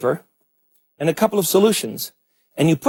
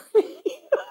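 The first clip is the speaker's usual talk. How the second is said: laugh; 4 dB quieter than usual. Real, not acted. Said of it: "I'm sorry".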